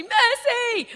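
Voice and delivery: high, loud cry of giving up; shouted mercy. Retold or imitated. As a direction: yell mercy like a beaten kid. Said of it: "at some point they say".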